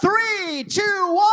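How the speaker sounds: high loud countdown. Real, not acted. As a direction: count them in loudly. Countdown to start the read.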